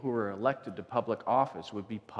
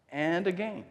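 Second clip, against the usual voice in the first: usual speaking voice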